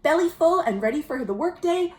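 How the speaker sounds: ordinary delivery, nothing marked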